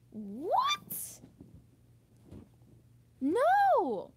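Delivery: high, loud disbelieving cry; incredulous. Real, not acted. Reacting to her mother's tears, high incredulous cry. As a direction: refuse it in utter disbelief.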